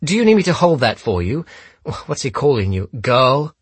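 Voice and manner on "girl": high, loud, rising question; mocking echo. A narrator's reading. Holmes repeats the gunman's name for his daughter, mocking. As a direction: throw the word back as a query.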